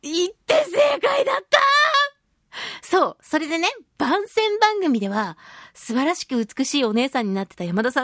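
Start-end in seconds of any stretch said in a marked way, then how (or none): 0.0-1.6 high, elated shout; pitched way up, bursting with delight; jubilant cry. Real, not acted. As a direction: cry out in triumphant relief. Jubilant verdict after going.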